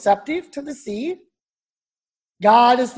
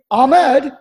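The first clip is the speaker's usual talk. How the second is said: Texan drawl on 'Ahmed', so it sounds like I'm Ed, louder; 7 dB louder than usual. Retold or imitated. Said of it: "people think I'm Ed".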